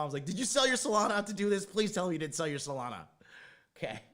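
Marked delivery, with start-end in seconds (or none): 0.3-3.1 louder, pleading worry; loud pleading question. Retold or imitated. Son asks mom about selling crypto for surgery, loud pleading. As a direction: beg her to say she didn't.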